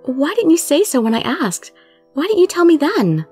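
high-pitched emotional questioning; upset demand. Acted. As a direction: demand it, pitch rising with feeling. Heroine demands answers from Subaru.

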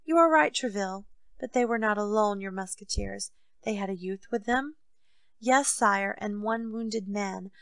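usual speaking voice

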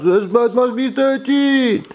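high, loud mock shout from a friend; Yampa shouting. Friend over a beer shouting at the builder. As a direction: belt it out like the excited French friend. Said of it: "he sits over there and he shouts".

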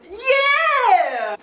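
put-on high loud cheer for the baby; excited cheer. Dad answering for his baby, high excited yeah.

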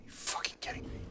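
unvoiced, stunned whisper; whispered disbelief. Real, not acted. Player repeats in disbelief at the grind, whispering. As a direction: breathe it out in shock.

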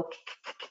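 "k" tapped out as short clipped k sounds, repeated. Shown as a demonstration. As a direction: bounce the k sound again and again, no vowel. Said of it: "it's hard to stretch out that k sound".

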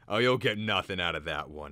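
loud scornful dismissal. A narrator's reading. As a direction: scoff at the customer's hopes. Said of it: "he said scornfully".

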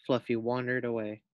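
plain reading voice

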